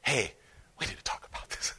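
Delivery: whispered, very soft "we need to talk"; soft parent talk. Retold or imitated. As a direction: hush it like a last-resort parent. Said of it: "You're like".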